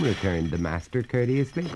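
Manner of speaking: usual speaking voice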